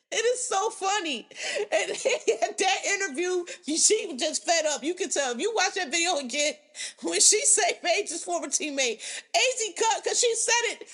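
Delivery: high and tickled, laughing through it; can barely hold it together; amused giggling. Real, not acted. Gossiping to viewers about the interview, giggling.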